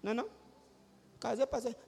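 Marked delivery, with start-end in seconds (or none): none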